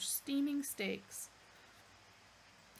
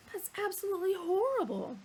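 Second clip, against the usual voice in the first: high, loud disgusted cry; exclaimed; 10 semitones higher, 6 dB louder than usual. Acted. Arthur appalled by the animal, exclaiming. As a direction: recoil and exclaim. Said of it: "exclaimed Arthur".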